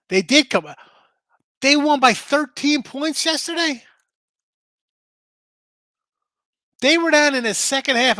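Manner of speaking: can't believe the comeback, voice shooting up; stunned surprise. Real, not acted. Host stunned to learn Siena won, shouting in disbelief. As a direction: pitch jumps high and loud in disbelief. Stunned the underdog came back.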